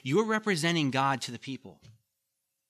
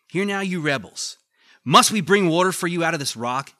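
louder, higher furious rebuke; angry Moses; 3 semitones higher, 9 dB louder than usual. Acted. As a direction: shout it at the grumbling crowd. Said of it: "So you can picture this".

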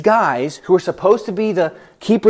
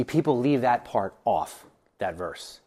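ordinary talk

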